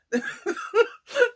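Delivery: a burst of high laughter. Real, not acted. Vlogger laughing at her protective-sister talk, high laughter. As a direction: laugh at her own bravado.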